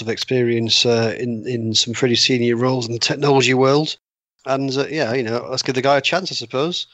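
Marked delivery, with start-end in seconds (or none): none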